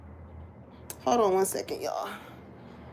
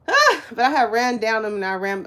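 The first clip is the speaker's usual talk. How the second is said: usual speaking voice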